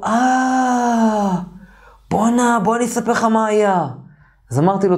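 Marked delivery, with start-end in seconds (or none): 0.0-3.9 high, drawn-out "ah" of realisation; sudden recollection. Retold or imitated. Telling his roommate what happened, in sudden realisation. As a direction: act out it suddenly coming back to you.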